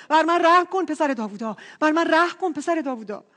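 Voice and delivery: high, loud repeated plea; imitated beggar crying for mercy. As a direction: call insistently over the hecklers. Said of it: "جیغشو بالاتر برد".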